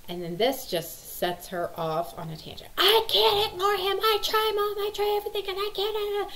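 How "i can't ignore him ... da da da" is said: very high, louder mock wail; imitating screaming daughter. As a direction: ham up a teen melting down, pitched way up. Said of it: "And she's screaming".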